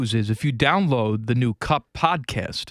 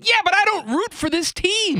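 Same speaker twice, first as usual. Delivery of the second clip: put-on much higher whiny kid voice; a kid talking back, pitched way up; bratty kid. Eight-year-old talking back to aunt, high whiny voice. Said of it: "I said".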